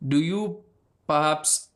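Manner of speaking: half-hearted Indian accent impression; bad Indian accent. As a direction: lightly put on an Indian accent through the nose. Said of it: "it's a really bad impression".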